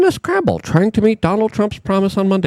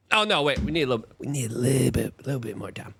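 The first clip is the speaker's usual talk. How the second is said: mock sheepish official; 6 semitones lower, 7 dB quieter, faster than usual. Mocking officials' stalling.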